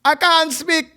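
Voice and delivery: high, slow Jamaican-Irish lilt; Jamaican accent turning Irish. Acted. Host failing at patois for his friend, Jamaican-Irish accent. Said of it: "when I try to do a Jamaican accent".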